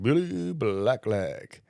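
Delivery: very slow sing-song chant; playful, elongated nickname call; drawn-out teasing nickname. Retold or imitated. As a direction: stretch it out like a taunt. Nickname chant repeated all day.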